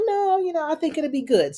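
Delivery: high-pitched Jada reply; voicing Jada. Jada answering Darnell in a high voice. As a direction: lift the voice way up as Jada.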